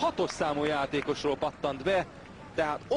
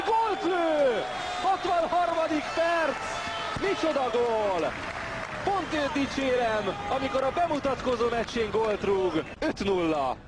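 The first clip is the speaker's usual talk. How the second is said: excited hungarian sports commentary; 9 semitones higher than usual. Real, not acted. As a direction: shout the goal, stretched and high. Debut goal, shouted commentary. (harsány, elnyújtott gólkiáltás)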